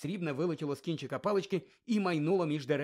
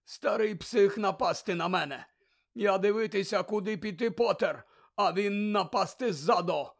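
lightly voiced foreign youth, slow and upset; hint of a dazed foreign champion; dazed foreign athlete; 6 semitones higher, 5 dB louder than usual. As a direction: shaken and indignant, hurried. Krum's broken-accented complaint.

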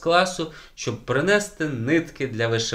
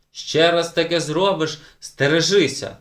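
loud, higher threat with a raised fist; threatening shout; 6 semitones higher, 6 dB louder than usual. A narrator's reading. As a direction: yell a warning at her. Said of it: "гукнув Уле". (погрозливий вигук)

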